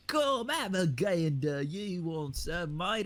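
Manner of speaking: exaggerated, slow, high-swooping cartoon British; hammed-up cartoon Brit; fake cartoon British accent. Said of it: "that really fake British accent that you hear in cartoons".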